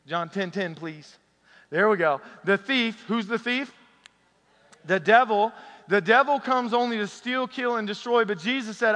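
loud, high preaching to the congregation; preaching at full force. Acted. Pastor rallying the church, preaching loudly. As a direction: lift the room with each line.

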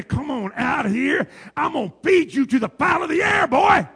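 loud, high booming giant's taunt; Goliath's challenge. Retold or imitated. Goliath shouting a challenge to the Israelite soldier. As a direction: call him out like a giant. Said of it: "the voice that was coming out".

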